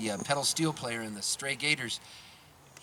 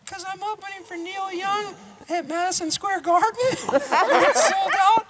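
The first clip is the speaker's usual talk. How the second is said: put-on much higher, jittery young singer's voice; 5 dB louder than usual. Nervous opener tells her idol she's scared, high giggly voice. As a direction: pitch way up, giggling through the nerves.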